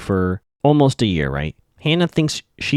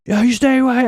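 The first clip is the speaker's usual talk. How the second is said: high-pitched caricature of a ranting man; mock angry yelling; 13 semitones higher, 3 dB louder than usual. Jason runs up to Hannah, hammed-up yelling. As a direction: imitate someone yelling, pitched way up. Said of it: "he just starts yelling at Hannah".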